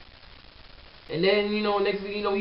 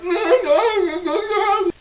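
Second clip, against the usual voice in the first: high strangled wail, no words; a squealing mock sob; imitated crying wail; 4 dB louder than usual. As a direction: mimic him bawling and unable to talk. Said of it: "but he couldn't".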